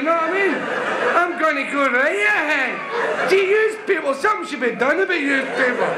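very high, ranting Glasgow caller; hammed-up high-pitched Glaswegian tirade; frantic Glasgow chap. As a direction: rant down the phone in a high, exasperated Glasgow voice. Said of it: "He says".

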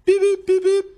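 very high, squeaked phone beeps; high electronic-style beep imitation. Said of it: "The phones should make that noise".

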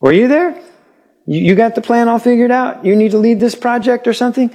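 high-pitched mock questioning; sarcastic challenge. The Lord to questioning Job about creation, sarcastic high-pitched challenge. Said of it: "He goes".

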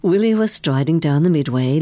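ordinary delivery, nothing marked

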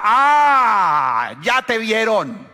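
loud, high mocking gotcha; wife's accusing cry. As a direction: catch him out, wife exclaiming. Esposa acusando al marido. (esposa acusadora gritando)

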